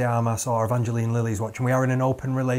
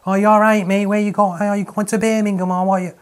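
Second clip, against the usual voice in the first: loud, high Mancunian-Scouse impression; exaggerated northern English accent, Mancunian sliding into Scouse; 8 semitones higher, 7 dB louder than usual. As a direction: put on a muddled Manchester-Liverpool accent. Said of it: "was I doing Mancunian or Scouse".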